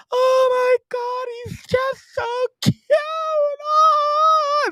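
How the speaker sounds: very high, slow mock squeal ending in a shrieking Oh; gushing women. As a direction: squeal over the cute dog like the bar girls. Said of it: "it's all".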